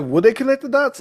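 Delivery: worried realization. Retold or imitated. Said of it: "I was like".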